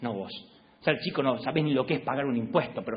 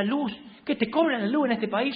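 higher, incredulous mock exclamation; mock shock at bills; 9 semitones higher than usual. New husband reading the electricity bill, mock incredulous. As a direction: act stunned by a utility bill. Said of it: "las agarraba así y decía".

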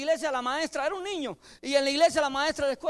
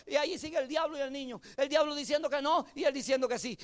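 usual speaking voice

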